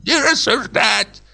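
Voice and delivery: put-on high, slow rattling old-man order; quavering old man. Old director on the sofa, quavering old man. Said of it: "и дребезжал".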